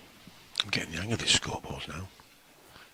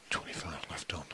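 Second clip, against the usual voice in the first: snooker commentary whisper; 5 dB quieter than usual. Acted.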